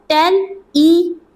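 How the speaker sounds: टेलीविजन slowly split as टे-ली-वि-जन; slow, separated teaching take of टेलीविजन; syllable by syllable. Shown as a demonstration. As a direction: sound out टेलीविजन with gaps between syllables. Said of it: "इधर देखो".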